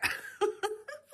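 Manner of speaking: a high-pitched real giggle that trails off soft. Real, not acted. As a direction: let a little high laugh escape. Laugh after camera remark.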